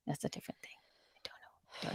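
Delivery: trailing off into a whisper; barely audible, giving up on explaining; near-silent whispered aside. Real, not acted.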